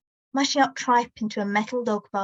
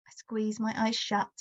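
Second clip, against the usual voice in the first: ordinary delivery, nothing marked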